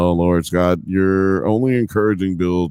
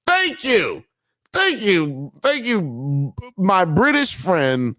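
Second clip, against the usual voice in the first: put-on British accent; 8 semitones higher, slower than usual. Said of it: "My British accent, way better".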